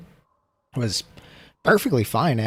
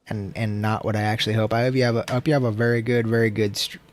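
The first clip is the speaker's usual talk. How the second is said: ordinary talk, nothing marked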